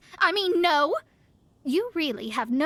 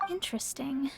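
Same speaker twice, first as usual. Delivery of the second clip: whispered to herself, much softer; whispered aside; 8 dB quieter than usual. Acted. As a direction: breathe it out as a private thought. Quiet aside while studying seal.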